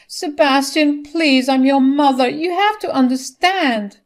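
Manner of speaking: high, loud pleading mother; distraught maternal appeal; desperate begging. A narrator's reading. Mother begs her son.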